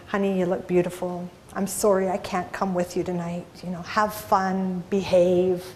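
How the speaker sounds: soft loving whisper-like tone; sweet nothings. Retold or imitated. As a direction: coo it sweetly into his ear. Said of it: "something sweet like".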